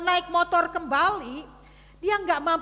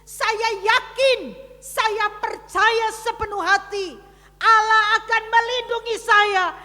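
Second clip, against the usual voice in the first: preaching declaration; 4 semitones higher, 6 dB louder than usual. Acted. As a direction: build the vow to a ringing shout.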